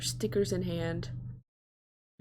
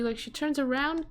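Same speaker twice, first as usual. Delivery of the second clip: ordinary delivery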